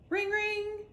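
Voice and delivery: high ringing ring ring; imitated phone ring. A narrator's reading. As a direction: mimic a payphone. Phone sound.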